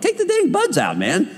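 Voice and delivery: high, exasperated mock plea. Preacher to gym guys with earbuds, high exasperated mock plea. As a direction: plead with them, half-joking. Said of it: "I'm like".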